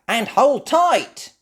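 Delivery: high, loud, slow warning; Miss Rabbit. Acted. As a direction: caution the kids cheerily.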